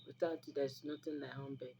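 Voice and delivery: ordinary talk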